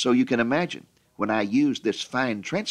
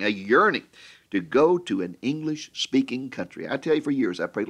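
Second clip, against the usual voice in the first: ordinary talk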